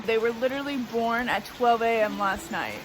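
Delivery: ordinary talk, nothing marked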